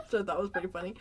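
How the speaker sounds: ordinary talk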